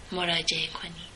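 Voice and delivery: soft unvoiced whisper; hushed closing; whispered sign-off. A narrator's reading. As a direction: breathe the last words out. Closing announcement, whispered end.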